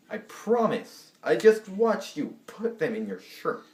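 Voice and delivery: high, exasperated mock pleading; acted-out exasperated protest; exasperated insistence. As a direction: insist in disbelief, pitch jumping up. Acted quarrel over denied plates.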